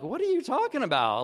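retold disbelieving retort to his wife; indignant disbelief. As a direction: push back, stunned and a bit offended.

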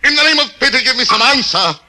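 desperate pleading. Acted. Captain begging the inquisitor how soon, frantic plea. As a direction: beg him for an answer, voice rising.

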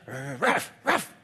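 low mock bark, ruff ruff; defiant puppy bark. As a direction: bark back like a sassy puppy.

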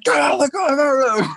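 imitating screaming soldiers; retold scream. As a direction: bark it like armed soldiers at a checkpoint.

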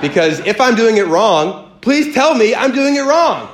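high-pitched, pleading mock outrage; hammed-up frustration, climbing sharply in pitch; comic exasperation. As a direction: build to an exasperated plea, played for laughs. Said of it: "it's making me angry".

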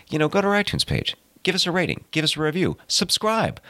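high, bright pitch ad-read; hyped-up plug. Acted. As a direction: pump up the listeners. Host's hyped plug for ratings.

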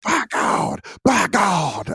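lightly voiced excited commentator; a hint of Jim Ross calling the match; Jim Ross impression. Owner calling dog's entrance, lightly voiced Jim Ross. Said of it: "I do the Jim Ross".

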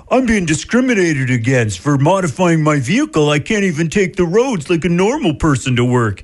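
a hint of a whiny put-on voice; lightly voiced aggrieved car owner; mock complaining driver. Radio host mocks the car owner, mild whiny voice. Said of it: "He doesn't talk like that".

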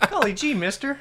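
put-on high gee-whiz kid voice; wholesome 1950s kid. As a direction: awestruck little boy. Joins the gee-whiz kid bit.

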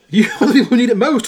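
storyteller's urgent called-out reply; calling back. A narrator's reading. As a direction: fire it back fast to the men below. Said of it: "Horton called back".

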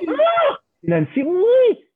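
high, loud, slow puppet cry of joy; puppet delight. Acted. Puppet Gustave thrilled to recognize Nancy. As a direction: sing out her name with puppet glee. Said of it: "c'est Gustave".

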